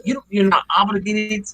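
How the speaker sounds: ordinary talk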